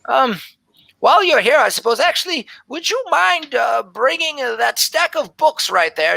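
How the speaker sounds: high, fluttery voice of an ancient elven woman; flustered old elf scribe. Acted. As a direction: play a scatterbrained old elf surprised by visitors. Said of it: "And she says".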